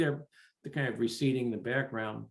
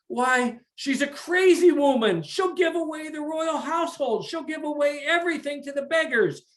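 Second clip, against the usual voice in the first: high, loud indignant ranting king; 11 semitones higher, 3 dB louder than usual. Acted. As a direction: rant that the girl is mad. King rejects the deal angrily.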